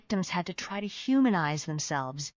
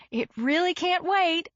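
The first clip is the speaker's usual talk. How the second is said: urgent; 10 semitones higher than usual. A narrator's reading. Narrator insists urgently.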